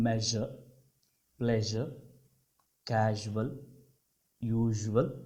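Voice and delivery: "మెజర్, ప్లెజర్, క్యాజువల్, యూజువల్" with a soft zh; light J. Shown as a demonstration. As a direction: say the J lightly, without the D. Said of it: "ఇక్కడ లైట్గా పలకాలి జాని".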